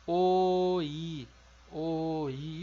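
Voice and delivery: o I with o and i kept apart; two clear vowels, no glide; separated o-i. Shown as a demonstration. Teacher repeating the word for students. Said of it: "Então, fica".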